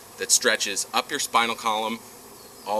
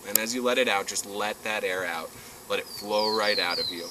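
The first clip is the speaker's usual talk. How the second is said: guided breathing; 6 dB quieter than usual. Acted.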